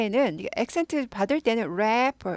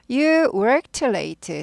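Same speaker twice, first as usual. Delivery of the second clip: You worked late said very slowly; slow demo read; 4 dB louder, much slower than usual. Shown as a demonstration. Teacher reads the example slowly for students. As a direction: stretch the sentence out. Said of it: "당신은 늦게 일했잖아".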